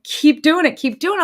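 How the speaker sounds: high, loud, mock-frantic urging; urging the trunk on. To her stuck trunk, high mock-frantic urging.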